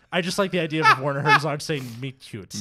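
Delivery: loud laughing delivery. Real, not acted. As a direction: laugh through the punchline. Laughing at own joke.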